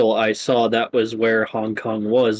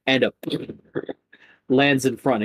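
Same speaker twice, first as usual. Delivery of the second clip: normal talking voice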